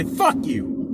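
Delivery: high-pitched dismissive curse; re-enacted parting shot; retold defiant retort. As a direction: act out cursing him off. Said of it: "Just like that".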